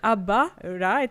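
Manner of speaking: usual speaking voice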